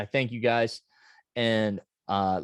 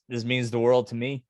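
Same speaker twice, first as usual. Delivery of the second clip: usual speaking voice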